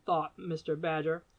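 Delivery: ordinary delivery, nothing marked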